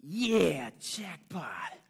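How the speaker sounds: loud, high acted cheer; triumphant shout. Shown as a demonstration. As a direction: yell it like a big win in a game. Partner echoes the improv direction.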